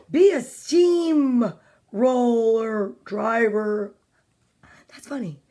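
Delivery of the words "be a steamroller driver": each word stretched, sinking on driver; very slow storybook suggestion; drawn-out slow. Acted. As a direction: plod through it like a steamroller. Drawn out for Mister Slow's job.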